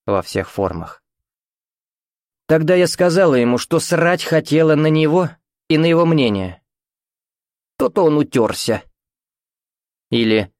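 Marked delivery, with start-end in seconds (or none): 2.5-10.5 higher-pitched, scornful mother; caustic, domineering mother. A narrator's reading. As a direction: brag with cold, self-assured contempt. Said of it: "Любимым её словечком было".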